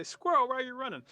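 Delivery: very high, very loud question; frantic shouted call. Acted. Animals catching up with Squirrel, hollering urgently. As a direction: holler after someone running off.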